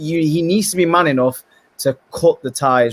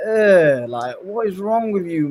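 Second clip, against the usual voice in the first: exasperated; 6 semitones higher, slower than usual. Retold or imitated. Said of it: "I was like".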